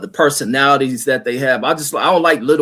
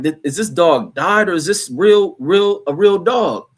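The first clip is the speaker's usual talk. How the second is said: higher, amazed disbelief; 5 semitones higher than usual. Retold or imitated. Admiring a dog's patches, amazed and higher. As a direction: marvel at the dog's coat. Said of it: "And I be looking like".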